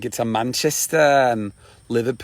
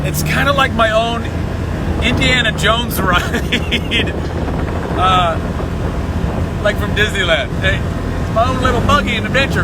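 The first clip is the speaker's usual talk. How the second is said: excited; 9 semitones higher than usual. Real, not acted. Riding buggy, laughing.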